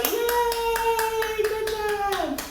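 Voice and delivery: high, drawn-out cheer; cheering audience. Acted. Teacher showing children how an audience applauds, high drawn-out cheering. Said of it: "that's when they go".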